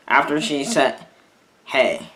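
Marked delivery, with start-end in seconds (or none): 1.7-2.1 low, subdued retold hey on the verge of tears; quiet, heavy hey from a tearful mom; depressed voice. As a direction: let it sink like she just finished crying. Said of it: "but depressed voice".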